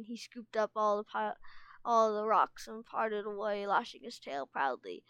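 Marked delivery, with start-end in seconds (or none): none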